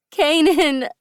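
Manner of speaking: high, loud climactic cry of his name. A narrator's reading. Heroine crying out her lover's name at climax. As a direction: shout his name at the peak. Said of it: "I cry out".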